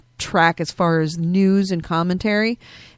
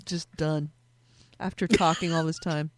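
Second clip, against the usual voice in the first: strained throat; 5 semitones lower, 5 dB quieter than usual. Real, not acted. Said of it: "My throat has just finally".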